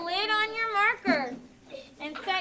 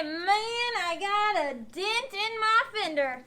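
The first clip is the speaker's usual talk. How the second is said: North Missouri drawl, slowed down, "dent" as "dint"; drawn-out North Missouri local speech; North Missouri accent; slower than usual. Shown as a demonstration. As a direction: demonstrate North Missouri talk, short i in "dent" and "fender". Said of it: "We here in North Missouri, we say".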